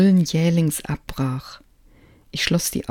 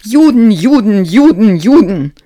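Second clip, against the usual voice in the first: high, loud, wailing screams; howling scream; 5 semitones higher, 12 dB louder than usual. A narrator's reading. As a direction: howl the word over and over. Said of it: "stand heulend ... und schrie".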